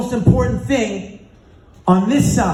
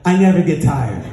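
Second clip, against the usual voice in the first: meaning it; 8 semitones lower than usual. Retold or imitated. Exhausted runner gives in to his partner's demand, meaning it. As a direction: give in and say it with conviction, still pitched low. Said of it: "Say it like you mean it".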